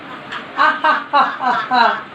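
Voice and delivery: forced "ha ha" exercise laugh; deliberate laughter-exercise ha-ha-ha; demonstration laugh. Shown as a demonstration. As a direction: laugh on command in even bursts.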